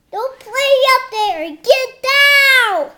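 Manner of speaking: high, loud scolding call; scolding shout. Acted. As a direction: scold them loudly. Child scolding dogs in story.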